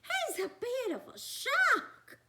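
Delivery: high, loud mother-monster voice; Missus Monster. Acted. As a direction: squeal it like a startled monster mum. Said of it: "said Missus Monster".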